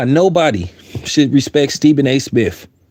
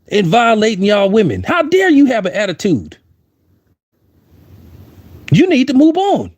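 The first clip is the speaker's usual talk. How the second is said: higher-pitched, scolding how-dare-you voice; mock indignation; 5 semitones higher than usual. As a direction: imitate an affronted accuser lecturing.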